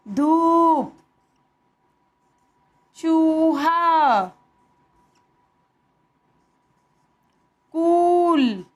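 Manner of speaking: धूप, चूहा, कूल with the ऊ stretched out; long ऊ words. Shown as a demonstration. Teacher modelling long ऊ words, slow and drawn out. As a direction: drag the long vowel in each word. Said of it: "लंबा खींच के बोला जाएगा".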